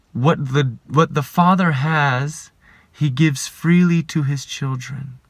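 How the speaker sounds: ordinary delivery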